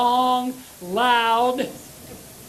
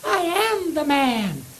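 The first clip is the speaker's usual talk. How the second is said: higher squeaky hello on the phone; 7 semitones higher, 4 dB louder, slower than usual. Retold or imitated. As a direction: answer the phone in a high squeak. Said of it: "embarrassing on the telephone".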